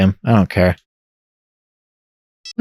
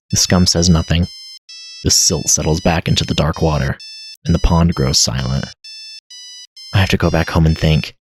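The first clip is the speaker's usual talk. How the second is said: game narration read. A narrator's reading. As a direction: read the scene like a grim novel.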